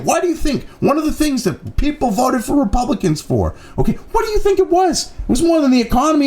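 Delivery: exasperated furious tirade; angry rant. Real, not acted. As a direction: vent it at full steam. Heated rant at opponents.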